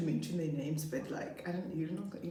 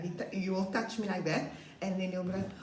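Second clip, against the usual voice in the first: ordinary delivery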